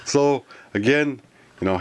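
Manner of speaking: ordinary talk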